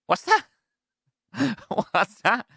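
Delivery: high, laughing disbelief; incredulous squeak. Real, not acted.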